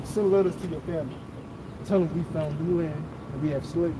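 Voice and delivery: usual speaking voice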